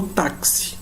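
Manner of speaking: "táxi" slowly, with a clear K-S. Shown as a demonstration. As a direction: stretch "táxi" to show the KS inside. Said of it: "tem som de K S". ("táxi" com X soando KS)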